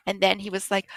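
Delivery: usual speaking voice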